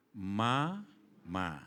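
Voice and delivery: low, drawn-out first word; mother teaching baby. Acted. Mother teaching her child to talk, gentle drawn-out tone. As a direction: coax a baby to repeat it slowly. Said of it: "Nadanya gimana, Bu, waktu ngajari anaknya?".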